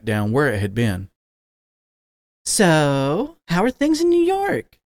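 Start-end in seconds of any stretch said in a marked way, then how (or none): 2.5-4.8 a much higher, lilting mom voice; sweet mother making small talk; mother's voice. A narrator's reading. Mom asking her son about New York, high and sweet. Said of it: "his mom asked".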